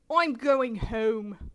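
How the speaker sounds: put-on slow, drawn-out British accent. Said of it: "do the British voice".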